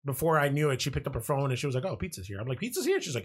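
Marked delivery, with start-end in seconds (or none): 1.9-2.4 low, soft, matter-of-fact; casual announcement. Retold or imitated. 2.6-3.0 pitched way up in disbelief; surprised. Retold or imitated. Surprised reply.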